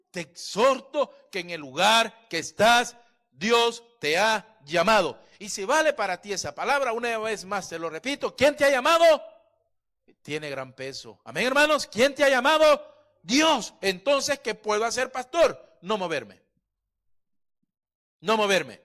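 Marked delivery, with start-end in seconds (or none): 0.1-9.2 full-force preaching. Acted. Pastor exhorting the congregation. 11.3-18.8 loud, high preaching calls; full-force preaching. Acted. Pastor calling to his congregation, loud.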